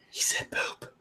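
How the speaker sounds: hushed mock whisper. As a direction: whisper like a nervous sponsor. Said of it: "they're gonna be like".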